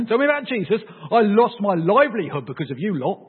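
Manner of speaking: high, mock-outraged comeback; indignant retort. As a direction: throw it back at them, aggrieved.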